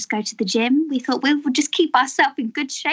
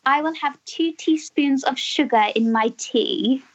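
slow, proper English accent; very proper English, Mary Poppins style; slower than usual. Shown as a demonstration. Giving the host a line to copy, very proper English accent. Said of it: "very proper English".

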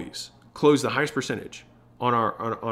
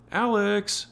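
ALEX high and drawn out; wife calling the name; 9 semitones higher than usual. Shown as a demonstration. Wife calls husband by name, high drawn-out call. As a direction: sing out ALEX, stretched and high. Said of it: "my wife, calls me and she says".

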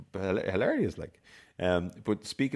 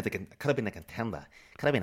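Brando contender line; 4 semitones higher than usual. Retold or imitated. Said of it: "a nod and a wink to On the Waterfront".

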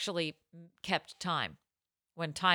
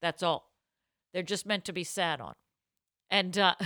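ordinary talk, nothing marked

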